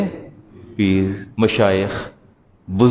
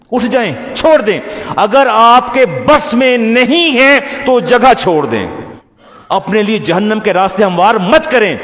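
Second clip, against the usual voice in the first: fiery rebuke; 9 semitones higher, 4 dB louder than usual. Real, not acted. Preacher rebuking audience.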